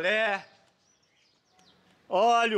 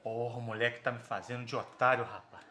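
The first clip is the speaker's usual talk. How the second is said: quiet grumbling; 9 semitones lower, 6 dB quieter than usual. Acted. Vendor grumbling to himself.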